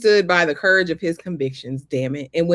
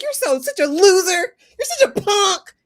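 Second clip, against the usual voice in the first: crying insult; 11 semitones higher, 4 dB louder than usual. Retold or imitated. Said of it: "She's like".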